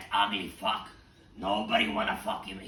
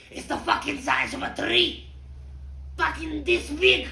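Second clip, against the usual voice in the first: shrieking much higher and louder; shocked outburst; 7 dB louder than usual. Acted. Prostitute shocked by the pig's size, shrieking much higher. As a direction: squeal in mock horror.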